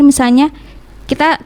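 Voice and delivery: ordinary delivery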